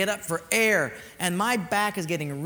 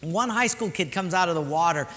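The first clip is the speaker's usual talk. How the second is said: ordinary talk, nothing marked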